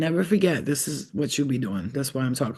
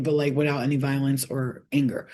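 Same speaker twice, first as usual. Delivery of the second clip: ordinary talk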